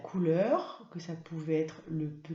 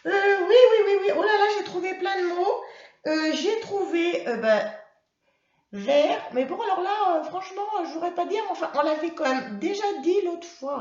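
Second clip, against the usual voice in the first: put-on high, loud childlike puppet voice; high-pitched, excitable classroom character; eager puppet pupil; 10 semitones higher, 6 dB louder than usual. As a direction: squeak out answers like a keen little pupil. Puppet pupil answers teacher.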